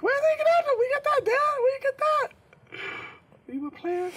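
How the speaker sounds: high, loud, mocking kid voice; a son teasing his dad about a hickey. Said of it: "I'm like".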